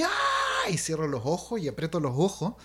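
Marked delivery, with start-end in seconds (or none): 0.0-0.7 very high mock bawling scream; re-enacted high-pitched cry; imitated child's crying wail. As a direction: burst out wailing like a frightened child. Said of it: "me largo a llorar así".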